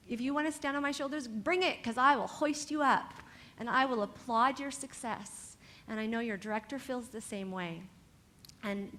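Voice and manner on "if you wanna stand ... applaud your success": loud, fast, fired-up challenge; rallying cry. Real, not acted. Coach urging the dancers to stand on her shoulders, loud and fired up. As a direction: pump them up, fast and loud.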